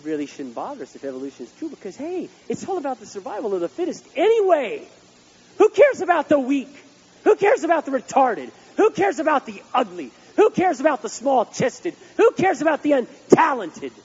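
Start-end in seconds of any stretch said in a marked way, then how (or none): 5.6-13.9 loud, high, scornful mock questions; sarcastic mockery. Preacher mocking the survival of the fittest, loud and scornful. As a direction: bellow each "who cares" like a heartless cynic.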